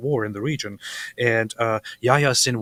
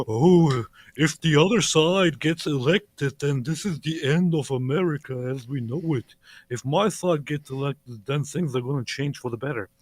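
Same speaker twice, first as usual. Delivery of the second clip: raised mock alarm about the election; mocking partisans; 4 semitones higher than usual. Cohost mocking voters each election, raised mock panic. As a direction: ham up the doom-saying partisan.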